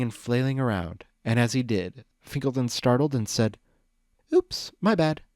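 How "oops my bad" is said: high, breathy female voice; breathy mare voice. A narrator's reading. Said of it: "in a breathy mare's voice".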